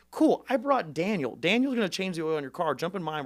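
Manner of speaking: high, loud, can-do reply; re-enacted gung-ho canvasser; eager campaigner. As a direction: sweep away the excuse with peppy insistence. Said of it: "I was like".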